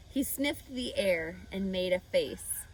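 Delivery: ordinary delivery, nothing marked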